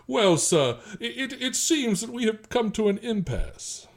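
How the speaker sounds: high, slow genteel Southern drawl; genteel Virginia accent. Shown as a demonstration. Asked to do one for us, hammed-up genteel Virginia accent. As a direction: put on a broad, courtly Virginia accent. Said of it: "the Virginia accent's very genteel".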